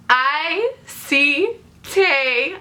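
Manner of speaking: very slow, each word stretched out; dramatic reveal. Retold or imitated. Fan reveals she spotted her idol, dramatic slow reveal. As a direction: draw out every word for the big reveal.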